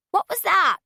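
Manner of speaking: high, loud alarmed call; startled old woman calling out; startled shout. A narrator's reading. Injured woman hears a thump.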